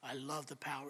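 low, soft near-whisper of the Satanist; lightly voiced sinister craving; hushed menace. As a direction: breathe the words, savouring them. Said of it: "And she said".

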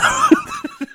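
squealing laugh; high laugh burst. Real, not acted. Laughing at own bit.

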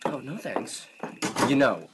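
ordinary talk, nothing marked

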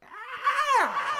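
very high sustained "ah"; drawn-out falsetto-high wail; long held high note. Retold or imitated. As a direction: imitate a singer holding a piercing note. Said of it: "you know, holds it".

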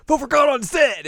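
high, loud eager cry; eager outburst. Retold or imitated. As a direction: blurt it out with excitement. Cuckoo's eager outburst retold.

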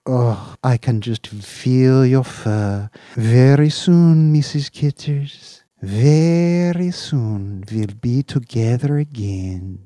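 slow, high, loud longing; longing coo. Acted. Owner promising his cat, slow and longing. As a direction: promise it slowly, yearning.

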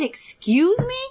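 high, rising, affronted question; indignant disbelief. Retold or imitated. Daughter answering mother's scolding, indignant disbelief. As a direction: act out being taken aback, pitch jumping up.

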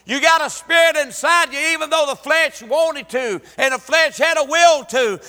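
loud, rising preacher's cry; full-force preaching. Acted. Preacher voicing the saved before God, full force. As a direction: preach it out to the whole church.

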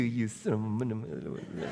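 soft, slowed curse under the breath; nice greeting turning into a muttered curse. Acted. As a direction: sneak the insult out quietly. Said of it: "curse them under our breath".

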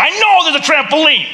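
tired dad who already knows; exasperated. Retold or imitated. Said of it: "I'm like".

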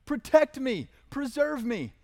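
high, loud pleading cry; voicing David crying for rescue; desperate cry for help. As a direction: cry out to God in danger. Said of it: "he starts out with a cry".

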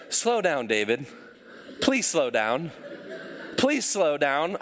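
the app pleading with David to slow down. Retold or imitated. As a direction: coax him to ease off, voice dropped low. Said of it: "it said".

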